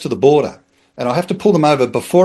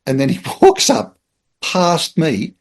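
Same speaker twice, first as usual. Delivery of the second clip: normal talking voice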